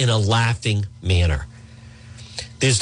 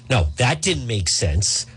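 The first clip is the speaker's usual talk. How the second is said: normal talking voice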